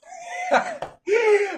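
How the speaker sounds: high shouting laugh. Real, not acted. Speaker bursting out laughing at the joke. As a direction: crack up hard.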